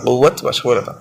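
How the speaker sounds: ordinary delivery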